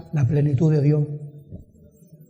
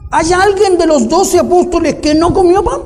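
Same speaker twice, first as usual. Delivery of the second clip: raised, high pulpit question climbing at the end; insistent preaching; 9 semitones higher, 6 dB louder than usual. Real, not acted. Preacher challenging the congregation about the twelve apostles, insistent. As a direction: press the rhetorical question on the listeners.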